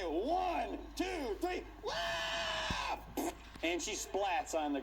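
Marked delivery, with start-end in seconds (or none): 1.8-3.3 high shouted whap; whap sound effect. Retold or imitated. Jezebel hitting the ground, high shouted whap. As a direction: smack the sound out.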